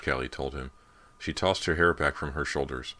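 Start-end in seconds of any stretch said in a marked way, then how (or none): none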